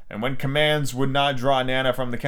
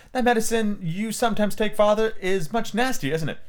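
put-on little girl voice; 6 semitones higher than usual. A narrator's reading. Wendy voiced in story.

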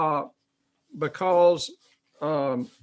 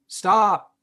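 stop; 4 semitones higher, 7 dB louder than usual. Real, not acted. Preacher commanding congregation.

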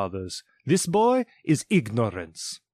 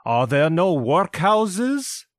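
slow, higher, rising on workhouses; mocking his own words; 8 semitones higher, 4 dB louder, slower than usual. Spirit taunts Scrooge with his own words, slow and cutting. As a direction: drag it out as a taunt. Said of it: "turning on him ... his own words".

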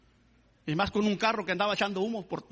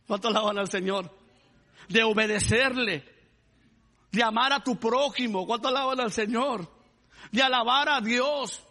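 raised, higher-pitched sermon climax; fervent preaching; 6 semitones higher, 4 dB louder than usual. Real, not acted. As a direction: preach it with full conviction, driving each phrase home.

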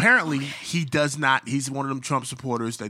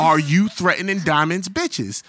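slow, louder, each word hit; slow confrontation; 5 semitones higher, 6 dB louder, slower than usual. Retold or imitated. Diamond confronting the man on the phone, slow and loud. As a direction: lean on every word, slowly. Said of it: "I said".

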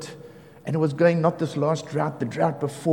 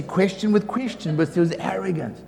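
ordinary delivery

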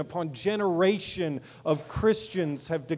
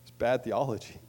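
ordinary talk

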